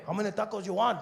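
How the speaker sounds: high, called-out vendor voice; taco truck vendor. Retold or imitated. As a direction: holler the order question. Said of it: "The guy comes to the window".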